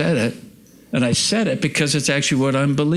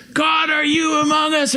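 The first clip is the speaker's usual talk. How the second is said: shouted at God. Retold or imitated. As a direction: shout the question right at God. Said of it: "just talking to him like".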